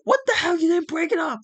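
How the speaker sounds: hammed-up angry shriek; mock furious yell. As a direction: scream at your useless partner. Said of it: "Curtis Axel's like".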